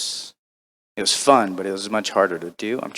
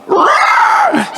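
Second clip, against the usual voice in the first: very high, loud rolled squeal; piercing creature battle squeal; goblin guinea pig scream; 8 dB louder than usual. Shown as a demonstration. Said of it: "just screaming goblin guinea pig".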